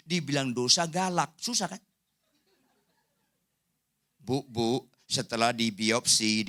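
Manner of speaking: ordinary talk, nothing marked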